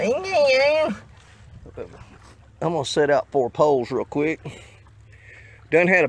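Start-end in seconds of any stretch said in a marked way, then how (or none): 0.0-0.9 high, dismissive mock voice; mocking people. Mocking people who doubt crawdad bait, high mock voice. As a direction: brush it off like the doubters do. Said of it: "A bunch of people talking about".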